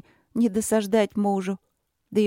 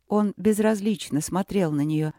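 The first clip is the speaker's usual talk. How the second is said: usual speaking voice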